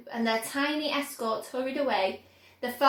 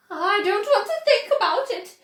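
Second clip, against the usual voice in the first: higher and louder, a disgusted shriek; 8 semitones higher, 8 dB louder than usual. A narrator's reading. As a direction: shriek in revulsion. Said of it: "shrieked Missus Gloop".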